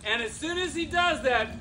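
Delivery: ordinary delivery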